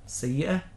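"السيئة" ending in an open a; haa heard as alif. Shown as a demonstration. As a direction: let the end of "السيئة" pass for an alif, no h. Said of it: "يتوهم أنها ألف".

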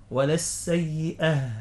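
slow "ولا السيئة" with a breathed h at the end; deliberate demo, "ولا السيئة" ending in a distinct h; clear final haa. Shown as a demonstration. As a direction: stretch "السيئة" and close on an audible haa. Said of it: "هكذا".

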